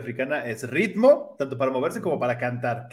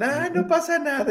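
mock carefree girl; 13 semitones higher than usual. Girls shrug off the laxative.

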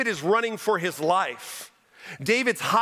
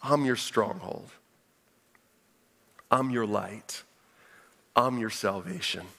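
soft, falling voice of God reassuring David; quiet, gentle promise getting softer; voicing God tenderly; 10 semitones lower, 3 dB quieter than usual. God reassuring David, soft and tender.

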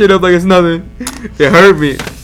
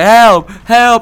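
raised, louder mock cry for help; imitated yelling; 5 semitones higher, 4 dB louder than usual. Imitating the girl yelling outside, mock yell. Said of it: "there was this girl yelling outside".

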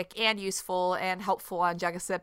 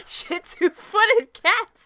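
laughing high mock; 12 semitones higher, 9 dB louder, much slower than usual. Mocking his reading.

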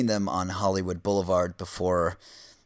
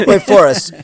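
blurting a name through a burst of laughter, louder; laughing; 9 semitones higher, 9 dB louder than usual. Real, not acted. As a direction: laugh while calling him. Laughing while cutting in.

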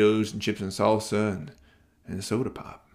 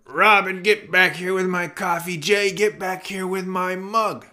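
higher calling voice of a dad; father calling out; 9 semitones higher than usual. A narrator's reading. Dad calling after his runaway sons. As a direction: shout after the boys to come back.

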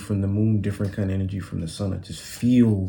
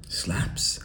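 put-on hushed, softer British-accented question; imitated British accent, under the breath; 7 dB quieter than usual. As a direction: whisper it like a baffled Brit. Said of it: "like, the legit accent".

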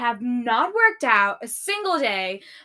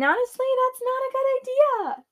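much higher, strained; squeaky warning; 13 semitones higher, 4 dB louder than usual. Real, not acted. Vlogger warning viewers about her crying, squeaky.

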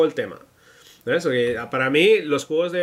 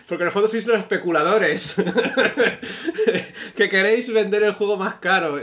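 higher-pitched, breaking into laughter; laughing teasing; 6 semitones higher than usual. Real, not acted. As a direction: laugh through a mock accusation. Teasing collectors while laughing.

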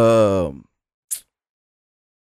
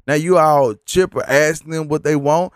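ordinary talk

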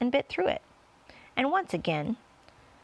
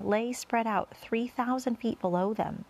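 ordinary delivery

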